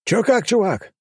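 high, bright greeting yell; joyful yell. A narrator's reading. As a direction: call out happily to an old friend. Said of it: "заорал я радостно". (радостно, высоко, на выкрике)

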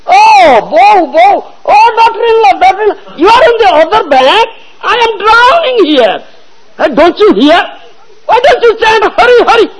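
high, loud desperate calling; drowning cry. Retold or imitated. Drowning mother-in-law shouting to her daughter-in-law, desperate. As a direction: yell for help across the river. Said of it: "drowning and shouting".

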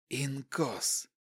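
quiet flat hiss. A narrator's reading. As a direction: hiss the word softly. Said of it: "Шипящий голос не выражал никаких эмоций".

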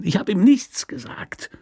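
whispered hiss so the guard can't hear; urgent hushed whisper. Acted. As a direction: hiss it without raising the voice. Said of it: "er habe gefaucht, ohne auch nur die Stimme zu erheben".

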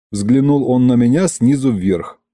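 ordinary delivery

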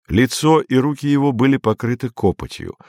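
usual speaking voice

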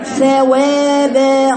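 ثوابا slow and chanted with all vowels tilted; wrong pronunciation. Shown as a demonstration. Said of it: "ثوابا خطأ".

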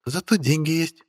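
brisk, high, cheerful reply; perky witch. Acted. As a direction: toss it back brightly. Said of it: "бодро отозвалась ведьма". (бодрая ведьма)

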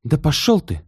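high snarled retort; snapping back. A narrator's reading. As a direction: snap back rudely. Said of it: "огрызнулась Таисия". (огрызнулась)

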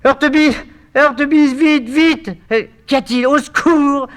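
high panicked shout for help; crying out. Acted. Eurydice screaming for Heurtebise to help. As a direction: scream his name in terror. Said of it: "se met à crier".

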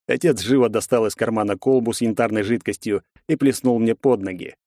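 ordinary delivery

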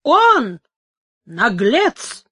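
loud outburst on the first word; shouted retort. A narrator's reading. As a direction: shout back with contempt. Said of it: "крикнул в ответ офицер". (презрительный выкрик)

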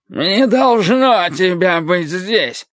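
put-on high, slow drunken yell; drunken shout. A narrator's reading. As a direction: shout it high, scared and slurred. Frightened drunk warns the narrator off.

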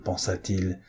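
plain reading voice

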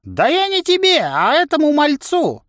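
put-on very high, irritated old man; exasperated old trainer. A narrator's reading. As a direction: correct them crossly in a high, strained pitch. Old trainer snaps back at the voice. (раздражённый старик, очень высоко)

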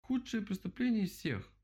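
high-pitched indignant squeal; outraged. A narrator's reading. Animals condemn the owl, outraged. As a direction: cry it out in shrill-voiced protest.

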